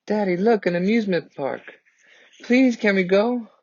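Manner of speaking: a little daughter begging her father for the amusement park; eager little girl. Acted. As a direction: beg Daddy like an excited small child.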